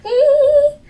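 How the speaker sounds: high, loud, sustained "eee"; piercingly high held string note; creepy string hiss. Shown as a demonstration. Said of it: "bit of that string hiss going".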